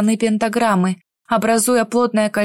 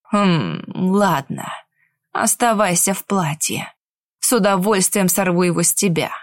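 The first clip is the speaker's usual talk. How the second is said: sinister villain; 4 semitones lower than usual. Acted. Villain mage mocks the captive.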